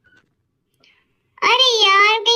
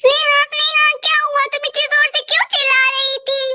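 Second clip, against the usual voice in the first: calling out; 4 semitones higher than usual. Acted. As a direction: call out, then ask fast what happened. Friend rushes in calling.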